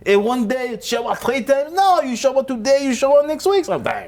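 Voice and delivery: put-on French accent climbing into high-pitched outrage. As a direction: build to a shrill French-accented rant. Mock Frenchman rants about showers.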